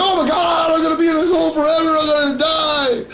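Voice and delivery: hammed-up high whining despair; mock despair. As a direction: panic that you're stuck forever. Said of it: "I was like".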